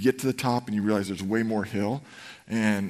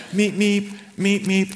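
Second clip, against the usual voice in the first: much higher, louder car-horn beeps; honking horn; 9 semitones higher, 6 dB louder, slower than usual. Retold or imitated. Wife honking her husband up the hill, loud mock beeps. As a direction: honk him on like a horn. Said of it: "she literally honked me up the hill".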